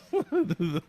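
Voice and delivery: short amused burst of laughter; real laugh. Real, not acted. Streamer cracking up at the haircut joke, laughing.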